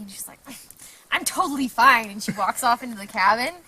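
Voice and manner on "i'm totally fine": raised, loud imitation of a huffy friend; snippy. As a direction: brush off the concern, mock-snippy. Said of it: "so she got all snippy".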